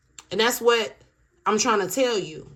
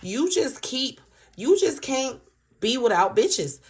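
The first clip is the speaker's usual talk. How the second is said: retold accusation; 6 semitones higher than usual. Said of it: "this third party is like".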